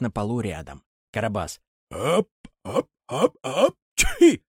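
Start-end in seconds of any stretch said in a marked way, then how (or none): 1.9-4.4 building sneeze breaking high and loud. Acted. Karabas sneezing by the fire. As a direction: wind up and burst into a sneeze. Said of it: "чихает".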